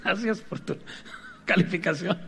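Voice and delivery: laughing through the thanks. Real, not acted. Thanking the audience for their rating, laughing. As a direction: break into real laughter on the last word.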